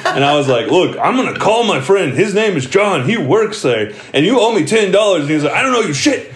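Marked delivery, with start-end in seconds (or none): 0.7-5.2 taunting. Retold or imitated. Narrator taunting drunk uncle over a bet, cocky. 5.5-6.3 much higher, laughing mock drunk yell; mock drunk screaming. Drunk uncle screaming at narrator, laughing mock yell. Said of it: "he's, like, screaming that at me".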